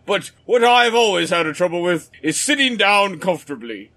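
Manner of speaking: a lightly put-on pompous British accent. Old British officer pitches the support group, light pompous British accent. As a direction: hold forth like a proud British veteran, faint accent. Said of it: "get my pompous British up and ready".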